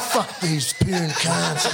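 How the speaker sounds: slow, laughing mock-tough jeer; mock drunken taunt. Drunk him jeering at the puny blokes, slow and laughing. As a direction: drag it out like a cocky drunk, laughing.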